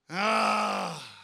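long loud groan under pressure; drawn-out "ah" of mock agony; strained groan. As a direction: groan as if being squeezed tight. Acting out the screws tightening.

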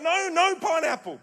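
loud, high retold protest without accent; accent dropped. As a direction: imitate an outraged refusal in your own accent. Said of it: "I'll forget the accent".

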